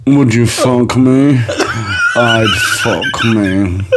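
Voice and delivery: slow, drawn-out creepy killer impression; Buffalo Bill. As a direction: purr it slowly like a film psychopath. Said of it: "Sound like Buffalo Bill from Hannibal".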